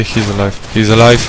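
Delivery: ordinary talk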